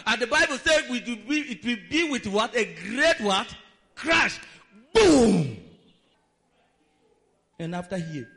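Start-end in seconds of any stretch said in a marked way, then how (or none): 3.9-5.6 sudden booming shout; a big onomatopoeic crash, nearly yelled; crash sound shout. Acted. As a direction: boom it out like the building crashing down. Preacher acting out the building's crash.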